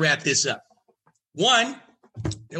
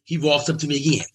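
ordinary talk

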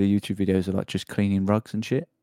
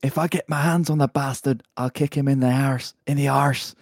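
mock Scottish; 3 semitones higher than usual. Reading the angry quote Scottish.

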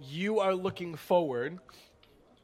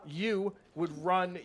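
ordinary delivery

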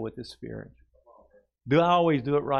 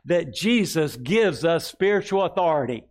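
slow and raised, for the church to repeat; slow proclaimed declaration; 5 semitones higher, 5 dB louder than usual. Shown as a demonstration. Pastor leading the congregation in a slow declaration. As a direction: declare it slowly and strongly. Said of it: "Say with me this here".

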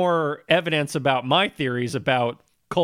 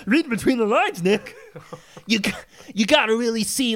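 higher, laughing take on a friend's voice; imitating a friend, pitched up and laughing; Mike Russell impression; 5 semitones higher than usual. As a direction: mimic a friend while laughing. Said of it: "that's Pee Wee Herman".

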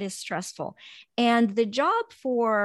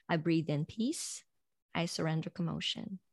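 calm affirmation; 4 semitones lower, 7 dB quieter than usual. Shown as a demonstration. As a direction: let each word settle on the breath. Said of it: "and start saying".

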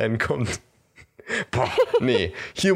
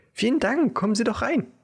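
high sweet voice; 13 semitones higher than usual. Acted. Hexe/Figur spricht freundlich.

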